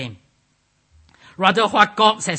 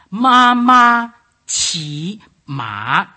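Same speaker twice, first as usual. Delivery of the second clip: high level "Ma, ma", rising "qi", dipping "ma"; exaggerated tone-by-tone reading; Mandarin tones; 4 semitones higher, 8 dB louder, much slower than usual. Shown as a demonstration. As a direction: hold "Ma, ma" high and loud, lift "qi", dip the last "ma". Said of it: "if you put into these tones".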